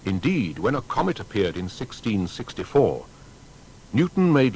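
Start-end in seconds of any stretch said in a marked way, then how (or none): none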